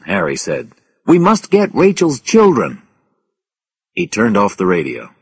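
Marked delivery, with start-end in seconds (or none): 1.1-2.8 loud, high and determined; urgent insistence. A narrator's reading. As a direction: insist in a raised voice. Urgent radio reply.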